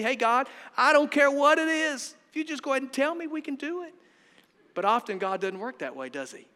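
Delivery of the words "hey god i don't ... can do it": high-pitched bargaining with God; mock pleading. Preacher imitating himself asking God for a plan, high mock pleading. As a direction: pitch it way up, easing off in volume.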